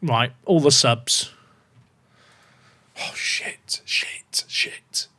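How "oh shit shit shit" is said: whispered swearing under the breath; anxious whisper. Real, not acted. Streamer sees trouble in the league, anxious whispered swearing. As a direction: whisper the curse over and over.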